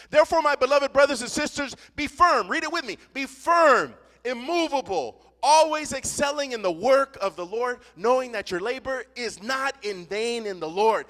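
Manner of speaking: preacher reading the verse to the congregation; scripture reading. Acted. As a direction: proclaim the verse to the church. Reads scripture aloud.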